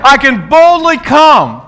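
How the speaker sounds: high, loud declaration for the church to echo; preaching at full force. Real, not acted. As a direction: proclaim it boldly. Leads declaration.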